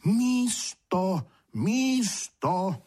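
high, syllable-split calling; emphatic repetition. A narrator's reading. Two sprites to a deaf one, calling it out carefully. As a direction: repeat it for a lip-reader, each syllable separate. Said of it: "Oba opakovali".

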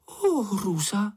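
high, slow, shocked gasp of horror; horrified cry. A narrator's reading. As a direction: cry out in high-pitched dismay. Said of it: "vykřikla". (vysoko a zděšeně vykřiknout)

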